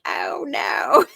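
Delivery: hammed-up cry of dismay, half laughing; mock scream. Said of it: "the person kind of screaming like".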